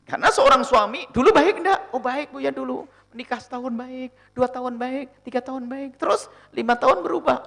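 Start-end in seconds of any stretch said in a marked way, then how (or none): 1.9-7.5 higher, laughing, sing-song reply; mock wife answering. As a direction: play the wife counting the years. (istri menjawab Buya, suara tinggi sambil tertawa)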